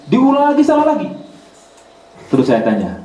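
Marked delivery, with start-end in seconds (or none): 0.1-1.1 exasperated. Real, not acted. Student repeats mistake again.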